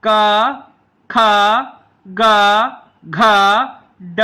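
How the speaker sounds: a teacher's call-and-repeat of the letters; clear letter drill. Shown as a demonstration. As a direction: say every letter slowly for the class to repeat. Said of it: "आप भी साथ में बोलें".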